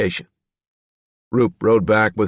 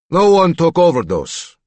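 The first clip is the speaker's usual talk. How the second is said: put-on curt Slavic accent, higher and louder; 9 semitones higher, 5 dB louder than usual. A narrator's reading. Reagan insists to Doctor Call, Slavic accent. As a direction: deny it flatly in a Slavic accent. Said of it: "that Slavic accent".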